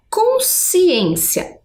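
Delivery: consciência said slowly, syllable by syllable; slow demonstration. Shown as a demonstration. Teacher to pupils, slowly sounding out the word. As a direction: stretch out consciência so the class hears it. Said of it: "temos a palavra".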